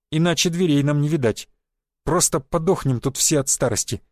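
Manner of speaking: ordinary delivery, nothing marked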